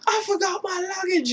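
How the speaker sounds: very high mock wail; Russell Brand. British rocker fleeing down the hall, high mock wail.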